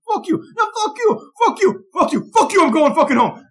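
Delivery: high-pitched mock shouting match; arguing brothers. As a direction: imitate two brothers cursing at each other. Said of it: "I don't know what instigated the argument".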